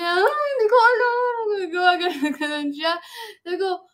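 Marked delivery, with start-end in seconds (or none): 0.2-1.1 high, loud imitated wail; mock crying. Imitating her friend crying, wailing she doesn't know. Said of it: "Tapos umiiyak na siya".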